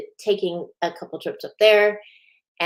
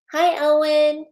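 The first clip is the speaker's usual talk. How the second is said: high, loud sing-out greeting; bright hello; 9 semitones higher, 7 dB louder than usual. Real, not acted. Host breaking off to greet a viewer, high loud hello. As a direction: call a hello to someone who just joined.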